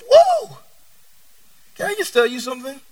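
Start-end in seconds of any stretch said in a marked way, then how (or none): 0.1-0.6 a sudden high, loud whoa; hammed-up astonishment; mock amazed whoa. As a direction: gasp out a whoa in fake wonder. Mock amazement at revived bones.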